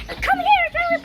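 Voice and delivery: high, loud call across the yard; calling the dog. Retold or imitated. Girl retells calling her dog away, high, loud shout. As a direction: call him over urgently.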